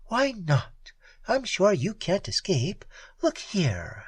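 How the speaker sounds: a hint of the cool Red Peri; lightly voiced Peri. A narrator's reading.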